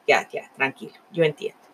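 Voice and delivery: soft, low, soothing; calm him down gently; old man soothes the rambling Love; reassuring. A narrator's reading. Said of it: "el viejito le dijo".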